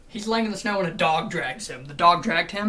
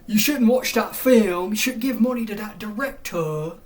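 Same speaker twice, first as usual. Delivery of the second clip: loud, higher mock scold; mock scolding fan; 4 semitones higher, 7 dB louder than usual. Mocking fans who tell others what to watch, loud mock scold. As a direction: boom it like a preachy gatekeeper.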